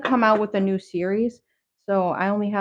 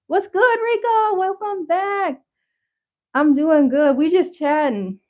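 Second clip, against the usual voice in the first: high-pitched greeting; 8 semitones higher, 3 dB louder than usual. Pretend. Greeting a viewer in chat.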